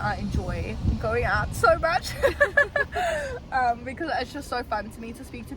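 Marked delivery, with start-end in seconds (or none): 2.2-3.5 a burst of high giggling laughter; high giggle. Real, not acted. Vlogger delighted about going out, high giggle.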